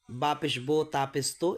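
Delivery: বো and তো ending in "oh"; wrong o vowel. Shown as a demonstration. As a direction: show the mistake, o instead of u in বো, তো. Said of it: "এভাবে হবে না".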